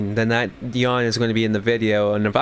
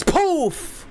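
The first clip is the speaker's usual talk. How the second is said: explosion noise. Retold or imitated. Mimicking an explosion.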